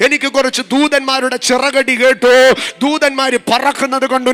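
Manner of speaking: loud, driving sermon that swells into shouts; fiery preaching. Real, not acted. Preacher to the congregation, rising into a loud cry. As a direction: preach it hard, building to a cry for the Lord.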